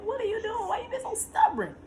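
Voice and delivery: high exasperated question; pleading with the stubborn baby; mock scolding baby. Scolding unborn baby, retold.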